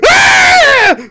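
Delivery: piercing high-pitched scream; loud wordless shriek of meltdown. A narrator's reading. DiCaprio kicking his chair away, shrieking. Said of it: "just starts shrieking".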